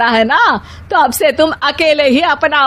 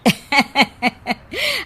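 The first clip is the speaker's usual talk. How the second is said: soft giggle before gossip; aunt's laugh; 4 semitones higher, 4 dB quieter than usual. Acted. Aunt chuckles while chatting about the groom. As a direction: chuckle knowingly.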